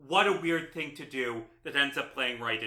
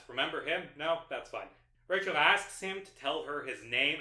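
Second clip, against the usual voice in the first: ordinary talk, nothing marked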